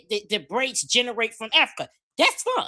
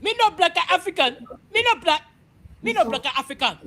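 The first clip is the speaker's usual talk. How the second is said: mock African accent; 5 semitones higher than usual. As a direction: ham up a broken African accent. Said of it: "they hear that accent".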